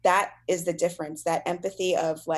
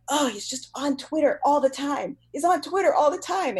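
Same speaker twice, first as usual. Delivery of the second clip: higher, exasperated gripe in self-parody; mock complaining; 8 semitones higher, 4 dB louder than usual. Wife complaining to husband about him being on Twitter, mock griping. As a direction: act out a high-pitched whine of complaint. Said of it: "I was complaining to my husband".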